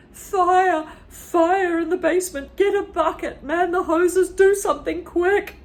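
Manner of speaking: frantic granny shouts; panicked yelling. Acted. Grandma panics.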